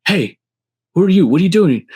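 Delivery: mock police bark at a stranger; cop barking.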